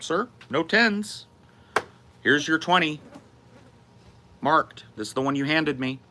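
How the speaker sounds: acting out a patient but pointed customer-service reply; retold firm explanation. Barista showing an accusing customer the twenty, pointedly patient.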